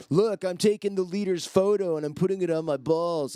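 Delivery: taunting the staff, hammed up; mock tourist taunt. Tourist mocking the guide in a high, taunting voice.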